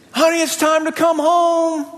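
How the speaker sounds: imitated Southern mom calling across the yard; mom calling kid home. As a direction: call him in for supper like his mama.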